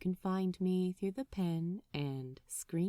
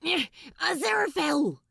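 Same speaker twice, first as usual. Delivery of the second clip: louder, higher, fast call; hurried call; 7 semitones higher, 4 dB louder, faster than usual. Acted. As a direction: rush him along. Crowley calling hurriedly.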